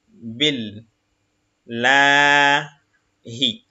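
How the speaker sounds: each Arabic syllable said apart, slowly; syllable reading. Shown as a demonstration. Teacher reading Arabic syllables to the class, slow and separated. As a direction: spell the word out syllable by syllable. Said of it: "syllable reading".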